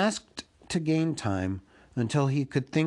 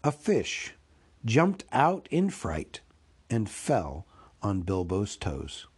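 ordinary delivery

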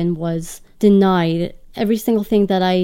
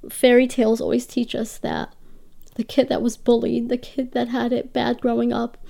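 tearful, higher voice through tears; crying; 4 semitones higher than usual. Real, not acted. Guest telling host about her lost hopes, tearful and higher. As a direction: push the words out while still crying. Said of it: "I'm crying".

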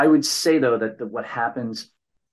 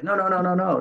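quick, high string of no's in mild mock protest; 9 semitones higher than usual. Driver fending off the yelling principal, high mock protest. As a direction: imitate the driver waving off the principal, pitched up. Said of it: "And then the guy was like".

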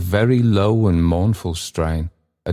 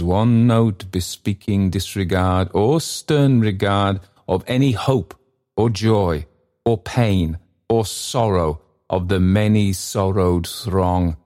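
plain reading voice

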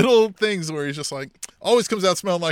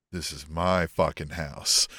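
low, slow, quiet menace; menacing claim; 9 semitones lower, 6 dB quieter, much slower than usual. Acted. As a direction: stake your turf in a low, drawn-out voice. Acting Swerve staking his claim.

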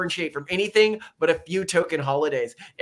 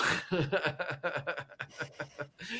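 long staccato laugh, softer; mock laughter; 9 dB quieter than usual. Laughing at how bad it is, staccato laugh. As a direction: run of quick, chuckling ha-has. Laughs after the meme line.

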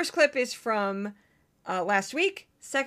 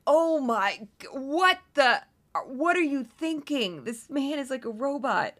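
higher, slow, incredulous groan at a bad host; mock exasperation watching a robotic anchor; exasperated disbelief; 4 semitones higher than usual. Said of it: "it's like".

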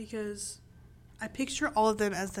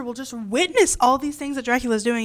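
ordinary talk, nothing marked

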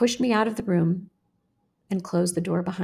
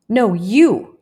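louder, swooping retort; urgent retort; 3 semitones higher, 8 dB louder than usual. A narrator's reading. One boy to another, insistent retort. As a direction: throw the dare back hard. Boy throwing the dare back.

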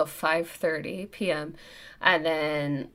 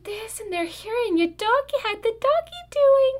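put-on very high, sing-song baby talk to a dog; puppy voice. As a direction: coo like a vet to a puppy. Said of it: "they're in permanent puppy voice".

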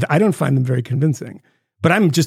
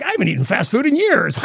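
Tucker gushing; 8 semitones higher than usual. Retold or imitated. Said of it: "And it's like".

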